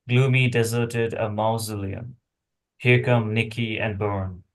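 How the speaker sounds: ordinary talk, nothing marked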